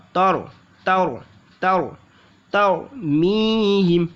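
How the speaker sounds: careful syllable drill; light t, full r. Shown as a demonstration. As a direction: repeat तर with a light त and heavy र. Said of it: "ता को तो त पढ़ेंगे र".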